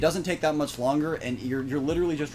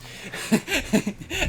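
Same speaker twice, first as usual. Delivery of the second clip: laugh. Real, not acted. Laughs at own ASMR voice.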